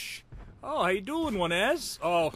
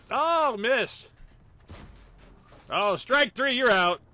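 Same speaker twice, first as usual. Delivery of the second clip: excited; 8 semitones higher, 3 dB louder, slower than usual. Acted. Mock baseball call on a miss.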